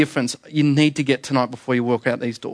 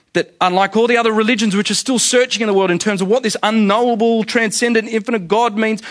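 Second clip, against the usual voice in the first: impassioned preaching; 6 semitones higher than usual. Real, not acted. Preacher driving the congregation toward the cross, impassioned and raised. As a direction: preach it with rising fervour.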